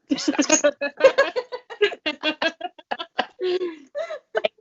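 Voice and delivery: long high burst of laughter; big laugh. Real, not acted. Host bursts out laughing at the throat punch joke, long high laugh. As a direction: crack up hard.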